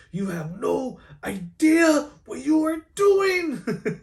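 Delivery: high, drawn-out hammed-up movie yell; imitated scream. Fan imitating Miguel screaming at Miles, mock scream. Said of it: "He's just screaming at him".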